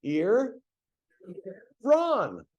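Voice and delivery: "Ear" then "Ron" said high and separated; slow syllables. Shown as a demonstration. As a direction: spell out "Ear" ... "Ron" one piece at a time. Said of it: "just as easy is this one".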